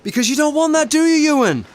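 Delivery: loud, high jeering challenge; angry taunt. Acted. Business manager taunting Ewan during the row, angry and loud. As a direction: throw it in his face. Heated company quarrel.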